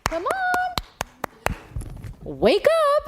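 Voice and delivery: high mom's wake-up call; imitating mom. Host imitating her mother waking her at dawn, high and bright. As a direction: chirp it like a cheery early-rising mom. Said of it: "this was her in the morning".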